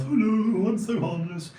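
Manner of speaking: high, loud silly hello; goofy, high-pitched self-mockery; Big Bird. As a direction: harmless children's TV character voice. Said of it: "Sesame Street, Big Bird, Justin House".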